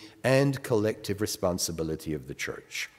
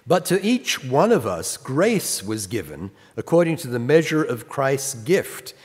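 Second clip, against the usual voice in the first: raised, measured Bible reading; scripture reading; 4 semitones higher, 4 dB louder than usual. Acted. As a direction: read the verse out with weight. Reads scripture aloud.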